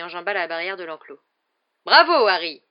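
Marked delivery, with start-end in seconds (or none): 1.9-2.6 loud, hearty roar. A narrator's reading. Hagrid cheering Harry's courage, roaring. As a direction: roar out the praise. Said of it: "rugit Hagrid".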